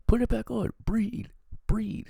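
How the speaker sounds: high, loud urgent commands; urgent medical yell. Retold or imitated. Medics shouting at an asthma patient who removed her mask, loud and urgent.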